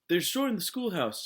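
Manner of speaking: high, loud, breathless alarm; teacher panting out a warning to the sheriff; gasping for breath. A narrator's reading. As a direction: blurt it out after running. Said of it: "she said, gasping for breath".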